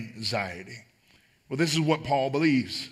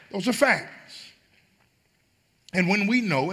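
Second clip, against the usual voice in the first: preaching at full force; 9 semitones higher, 5 dB louder than usual. Acted. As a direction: cry it out to the congregation. Preaching climax.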